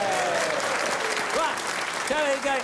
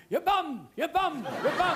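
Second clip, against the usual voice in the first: mock shouting; 7 semitones higher than usual. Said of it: "I heard them shouting".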